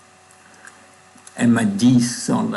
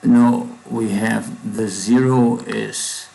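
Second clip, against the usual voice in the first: normal talking voice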